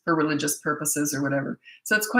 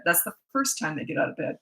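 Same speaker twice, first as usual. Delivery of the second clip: ordinary talk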